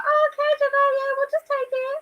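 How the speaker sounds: high, mock-submissive voice; mock meek giving in. Mocking friend who wants them to back down, high meek voice. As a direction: play the pushover mockingly. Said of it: "You just want us to be like".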